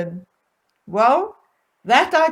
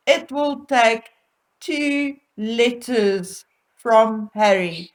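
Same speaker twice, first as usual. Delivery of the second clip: ordinary talk, nothing marked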